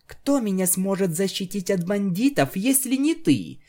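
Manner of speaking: high, hammed-up pleading girl; theatrical damsel. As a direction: play the helpless damsel. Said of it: "в театральном стиле".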